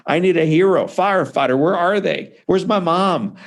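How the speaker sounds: a mild mock cry for help; panicked child. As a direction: relive the terror of needing rescue. Reliving being on fire as child.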